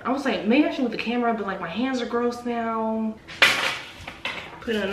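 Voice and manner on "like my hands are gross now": usual speaking voice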